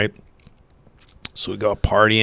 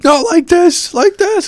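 very high, louder furious shout; furious coach yelling; 7 dB louder than usual. Retold or imitated. Coach showing the players the right way, high furious yell. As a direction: scream it at the kids in disbelief. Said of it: "He was so pissed".

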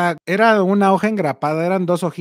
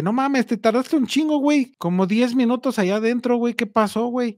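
higher-pitched retold complaint; imitating worried friend; 6 semitones higher than usual. Friend complains about the long wait. (amigo alarmado, con voz más aguda)

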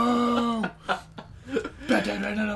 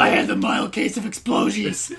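deadpan rasping hero; raspy superhero dad. Acted. Friend explains himself to laughing teammate, Bat Dad rasp. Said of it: "not loving your voice".

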